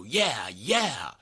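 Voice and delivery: loud fist-pump cheer of triumph; an exultant "yeah, yeah!" shouted in celebration; victory yell. Pretend. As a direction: cheer like you just won the game. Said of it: "and you go".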